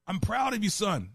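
lifted high, a proud fatherly declaration; God the Father voiced. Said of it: "So God the Father is saying".

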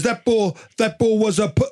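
high, hammed-up English accent impression; poor English accent. Co-host imitating Jimmy Graham's call to his partner in a mock English accent. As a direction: mimic the commentator's fired-up call in a bad English accent. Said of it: "that's a really poor English accent".

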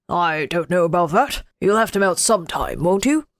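put-on a sly old king; Winter King. A narrator's reading.

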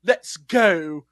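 slow, affected British fop; mock British dandy, languid; foppish British. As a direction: drawl it like a foppish British actor. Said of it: "sort of a foppish British dude".

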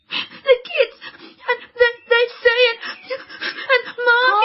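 high, fast, broken words through tears; sobbing. Acted. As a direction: choke out the words while crying. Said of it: "Why are you crying?".

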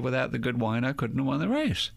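ordinary delivery

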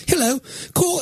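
high, put-on British phone voice; posh British command to the phone; British accent. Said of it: "Why am I speaking in a British accent".

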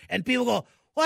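ordinary talk

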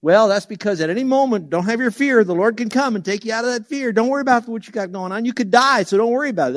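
high, loud mock preaching; mock voice of other writers, loud and high; mocking other view. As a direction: ham up the preachy warning. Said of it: "writers will say".

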